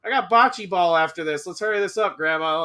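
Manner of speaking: high, careless bro voice; douchey bro doctor. Retold or imitated. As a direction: toss it off like a jock doctor. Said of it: "the guy who's like".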